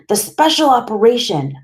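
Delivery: high, loud, insistent reminder; upset insistence. A narrator's reading. Boy reminding doctor of promised operation, upset insistence. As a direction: insist on it, pitch and volume up.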